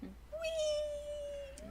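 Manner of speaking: high squeal of glee; gleeful whee. Acted. Voicing the chair spin, a high squeal. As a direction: squeal while spinning.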